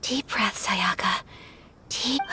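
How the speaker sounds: whispered pep talk to herself, soft; steady your nerves under your breath; murmured deep breath to herself; hushed self-coaching. Acted. Calming herself before asking.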